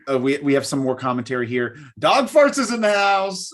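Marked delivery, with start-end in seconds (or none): 2.0-3.5 higher, drawn-out hype greeting; slow, raised-pitch shout-out; playful shout-out. Pretend. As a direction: announce the guest with mock fanfare.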